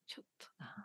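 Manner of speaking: breathy whispered reluctance; whispering. Real, not acted. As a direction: whisper it to yourself, backing out. Declining exercise, under her breath.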